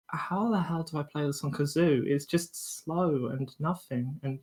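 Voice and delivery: half under the breath, lost; mock bewildered mutter. Kazoo player retelling his confusion, soft bewildered mutter.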